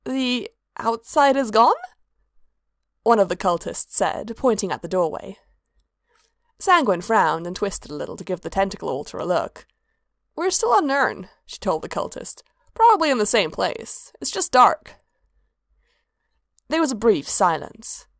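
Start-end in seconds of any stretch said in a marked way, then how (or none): 0.1-1.8 higher, slow, startled question; stunned disbelief; bewildered. Acted. Cultist, bewildered. 10.4-15.0 higher, playful trickster voice; casual and impatient, searching for words; lively Sanguine voice. Acted. Sanguine reassuring.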